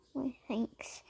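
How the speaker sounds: whispered thanks. Acted. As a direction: say it in a soft whisper. Rescued dog thanks quietly.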